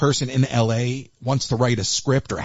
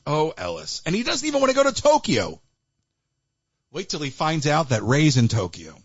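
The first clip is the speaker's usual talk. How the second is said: ordinary talk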